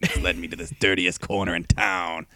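smoker's rasp, detective narration; noir detective voice. Acted. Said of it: "smoker's cough".

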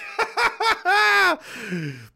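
real laugh that jumps way up in pitch; high laugh. Real, not acted. Streamer laughs at the playlist's name, high real laugh. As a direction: crack up, high and free.

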